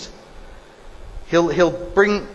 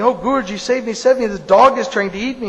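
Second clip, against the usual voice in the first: higher-pitched mock pleading; urgent plea; 4 semitones higher than usual. Cat runs to the guru for rescue, pleading urgently. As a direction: beg for rescue in a raised voice.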